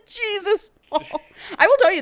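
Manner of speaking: high laughing disbelief. Real, not acted. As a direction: squeal it through laughter. Laughing at the book.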